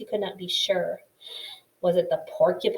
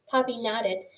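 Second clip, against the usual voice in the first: usual speaking voice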